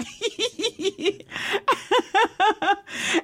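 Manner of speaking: long, high tittering laugh; high giggle. Real, not acted. Laughs at her own joke.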